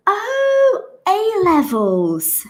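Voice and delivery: high-pitched drawn-out "oh" of understanding; British lady's realisation. Retold or imitated. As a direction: imitate the lady finally getting it, starting very high. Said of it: "So she goes".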